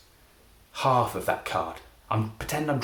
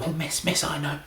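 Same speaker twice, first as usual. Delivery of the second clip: eager student; 8 semitones higher than usual. Retold or imitated. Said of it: "some student would go".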